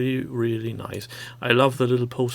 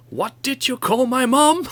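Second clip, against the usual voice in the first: high, shouted squawk of outrage; angry bird; 11 semitones higher, 6 dB louder than usual. Retold or imitated. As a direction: yell it like an offended little bird. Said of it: "the bird is totally mental".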